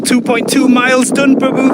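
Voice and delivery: calling out over the wind; wind in the face. Real, not acted. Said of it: "It's too windy to talk".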